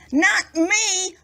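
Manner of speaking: wife refusing. A narrator's reading.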